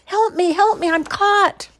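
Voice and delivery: very high, loud squeaking plea; panicked cry for help. Acted. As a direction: shriek for help. Mouse trapped by tail.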